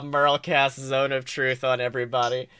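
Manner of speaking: usual speaking voice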